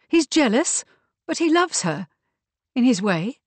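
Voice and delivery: high, insistent defence with doubt creeping in; doubtful. A narrator's reading. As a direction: defend him, not wholly convinced. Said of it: "there was doubt in her voice".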